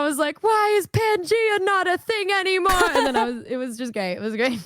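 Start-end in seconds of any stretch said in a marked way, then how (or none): 0.4-2.7 high-pitched mock wail; retold crying. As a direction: wail it as if in tears, hammed up. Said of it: "then I cried for".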